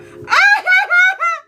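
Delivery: high shrieking laugh of delight; shrieking with glee. Real, not acted. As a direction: burst out in a squealing laugh. Excited reaction to reading.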